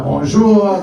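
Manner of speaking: hammed-up French accent. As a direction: greet a stranger like a cartoon Frenchman. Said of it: "pee like a Frenchman".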